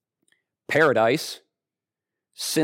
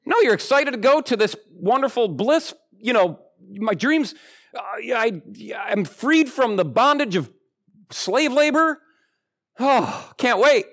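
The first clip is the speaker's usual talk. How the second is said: excited; 7 semitones higher than usual. Retold or imitated. Giddy vacation anticipation.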